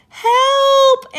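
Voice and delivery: high, loud cry for help; yell for help. A narrator's reading. Andrea drowning in the ocean, yelling for help. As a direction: shout for rescue. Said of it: "Andrea yelled".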